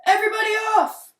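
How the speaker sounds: high, loud and fast order; loud command. A narrator's reading. Dad ordering the kids off the chair, loud and quick. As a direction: shoo everyone off the chair. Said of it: "This load is too much".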